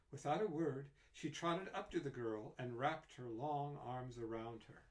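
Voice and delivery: plain reading voice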